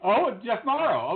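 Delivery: high drawn-out oh of recognition; realizing who he means; sudden recognition. Real, not acted. Friend finally recognizes the chef name, surprised.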